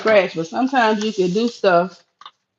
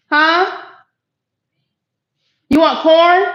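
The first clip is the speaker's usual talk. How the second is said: higher, louder call across the house; calling out to her daughter with a question; yelling to daughter; 7 semitones higher, 3 dB louder than usual. Real, not acted. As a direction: ask loudly so she hears outside. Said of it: "I'm sitting there yelling at my daughter".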